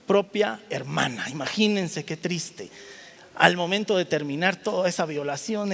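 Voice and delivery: ordinary delivery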